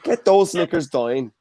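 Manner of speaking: mock Northern Irish seducer; Northern Irish accent. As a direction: imitate a hard Northern Irish accent for a laugh. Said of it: "with the Northern Irish accent".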